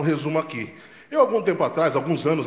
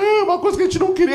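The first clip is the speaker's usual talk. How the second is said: imitating a high-pitched wail; mock sobbing, voice way up high; crying mother; 13 semitones higher, 3 dB louder, faster than usual. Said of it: "Minha mãe chorou".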